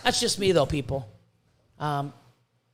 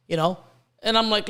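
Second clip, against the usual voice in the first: ordinary talk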